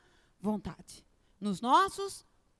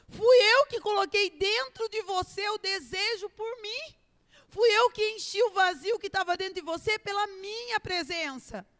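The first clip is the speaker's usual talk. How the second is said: preaching God's voice; 6 semitones higher than usual. Acted. Preaching God's voice at full force.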